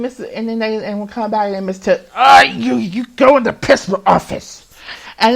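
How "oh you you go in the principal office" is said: loud, sharp teacher voice; mock stern order. Teacher sending the boy to the principal, loud and stern. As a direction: play the teacher barking orders.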